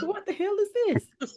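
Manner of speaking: high, soft, laughing imitation; amused high-pitched mock bafflement; voice shooting up. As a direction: echo his startled rise with a laugh. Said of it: "his voice went up, like, two octaves".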